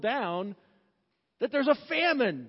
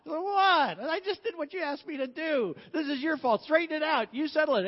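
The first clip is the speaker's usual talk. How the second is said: indignant protest; 6 semitones higher than usual. Retold or imitated. Abraham quarrels back.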